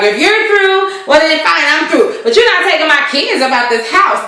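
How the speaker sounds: husband telling wife he's done; stern husband. Retold or imitated. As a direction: lay down the law to the wife. Husband's retold angry reply.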